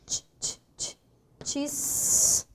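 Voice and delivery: t, t, t, tis as voiceless taps; teacher sounding out cartista; isolated T sound. Shown as a demonstration. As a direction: tap out the T before tis.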